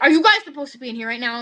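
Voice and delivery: loud, quick challenge of an officer; confronting officer. Retold or imitated. Police officer confronts students in the choir room, loud and confronting. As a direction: demand it like an officer catching students.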